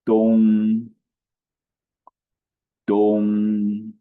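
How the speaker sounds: Dong held high, level and loud; first tone demo. Shown as a demonstration. Teacher modelling east in Chinese, high level tone. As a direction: keep Dong flat and high like a held note. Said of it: "How do you say east in Chinese?".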